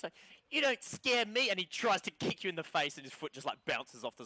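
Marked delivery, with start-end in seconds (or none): 0.5-1.5 high, loud defiant little voice; defiant kid. Acted.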